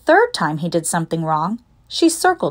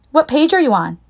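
higher-pitched, insistent question; pushy, raised-pitch demand; 7 semitones higher, 3 dB louder than usual. A narrator's reading. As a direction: demand an answer from a classmate. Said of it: "she demanded".

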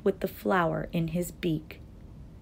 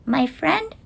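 high-pitched small-creature voice; tiny speck voice; 10 semitones higher than usual. A narrator's reading. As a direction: speak up as someone minute. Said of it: "came the voice".

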